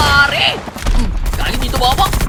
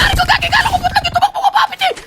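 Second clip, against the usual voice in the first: panicked shout; faster than usual. Real, not acted. Panicking when enemy appears.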